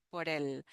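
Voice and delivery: por el blended as one word, porel; teacher showing the blend por el; linked words. Shown as a demonstration. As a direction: run por el together without a break. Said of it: "like if it will be one word".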